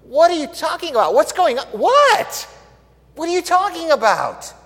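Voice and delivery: high, loud stunned king; shocked disbelief. Acted. King stunned loudly by the queen's news. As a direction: blurt it out in total shock. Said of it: "It's like".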